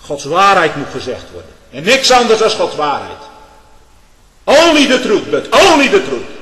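loud, high proclamation of the truth; thundering preaching. Acted. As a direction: proclaim it at full force from the pulpit. Preacher thunders about God's truth. (donderend preken)

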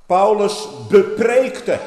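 slow, loud and high; emphatic outburst. Acted. Preacher stressing that Paul preached, slow loud call. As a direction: hammer it out slowly.

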